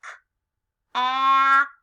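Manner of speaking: "K, ap" split, with a loud held "ap"; crisp lone "K", then long loud "ap"; stretched-out word. Shown as a demonstration. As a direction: sound out "K, ap" with a pause after K. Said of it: "Stretch out cap with me".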